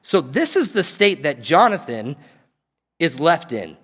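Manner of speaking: ordinary talk, nothing marked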